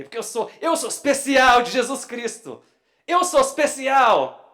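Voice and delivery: loud, high, exultant proclamation; excited. Shown as a demonstration. Preacher getting worked up about being special in Christ, excited shout. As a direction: belt it out, carried away with excitement. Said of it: "É gostoso ficar meio empolgado".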